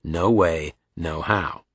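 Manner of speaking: usual speaking voice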